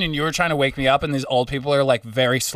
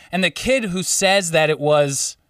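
usual speaking voice

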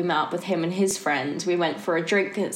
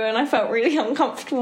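ordinary talk

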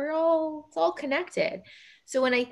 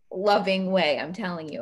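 normal talking voice

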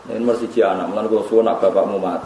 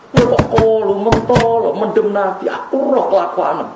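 Fatimah scolding Anas; 10 semitones higher than usual. Retold or imitated. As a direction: scold him like a heartbroken daughter. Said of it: "Bareng dicewelok Sayyidah Fatimah". (Fatimah menegur Anas dengan suara tinggi penuh duka)

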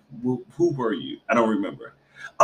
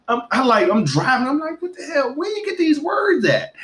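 shocked; 13 semitones higher than usual. Retold or imitated. Mother in the car shocked by her son, high incredulous. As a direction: squeal in disbelief at your son.

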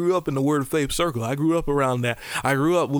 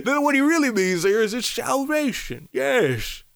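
high mock pious accent with a touch of Bane; weird religious-people accent; 8 semitones higher than usual. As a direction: exaggerate an odd churchy accent. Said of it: "sound like a mix between Bane".

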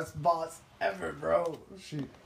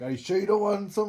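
coworker's offer; 6 semitones higher, 5 dB louder than usual. Retold or imitated. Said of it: "He was like".

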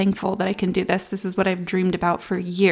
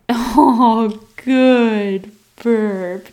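louder bright little cheer; pleased exclaim; 2 semitones higher, 8 dB louder, much slower than usual. Real, not acted. Mom delighted when her baby burps, louder praise. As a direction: cheer the burp with a laugh.

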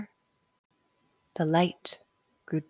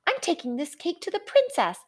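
defiant shout; 11 semitones higher, faster than usual. A narrator's reading. Jack fighting off birds.

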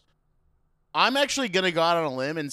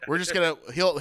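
usual speaking voice

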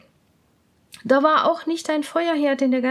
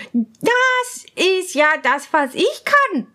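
higher, slow, delighted princess; a pleased royal girl, pitched up; 6 semitones higher, 4 dB louder, slower than usual. Acted. Princess recognises her tune, high and delighted. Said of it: "sagte sie".

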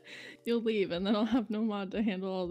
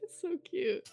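ordinary delivery

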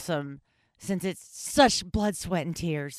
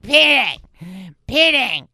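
drunk voice imitation; 3 semitones higher, 8 dB louder, slower than usual. Mocking her old drunk self calling the cat, rough drunken holler. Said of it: "I fucking sound like [name]'s esophagus".

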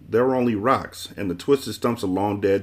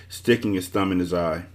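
plain reading voice

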